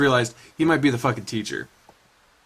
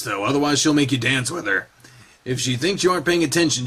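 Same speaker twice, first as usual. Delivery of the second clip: normal talking voice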